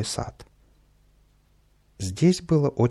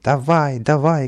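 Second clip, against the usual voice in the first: loud, raised egging-on shout; крик; 4 semitones higher, 6 dB louder, slower than usual. A narrator's reading. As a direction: urge them on loudly. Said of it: "кричал Каприска". (азартно кричит, подгоняя)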